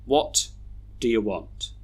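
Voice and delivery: "do you" reduced to a quick duh-yuh; schwa weak forms. Shown as a demonstration. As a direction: pause after "What", then weak "do you". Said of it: "we should read these sentences like this".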